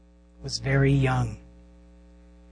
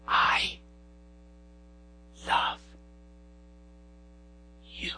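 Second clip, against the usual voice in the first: voiceless, slow I love you; whisper; 7 dB quieter, slower than usual. Retold or imitated. Daughter whispering love into daddy's ear, voiceless. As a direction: breathe it with no voice. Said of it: "whisper in her ear".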